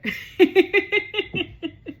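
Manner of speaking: high, loud burst of laughter; emotional laugh. Real, not acted. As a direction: laugh it off while close to tears. Laughing while fighting back emotion.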